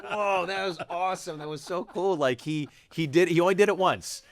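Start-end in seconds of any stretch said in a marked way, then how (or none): 0.0-2.2 higher, delighted gushing; higher delighted whoa; thrilled. Retold or imitated. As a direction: gush like a starstruck fan. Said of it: "I was like".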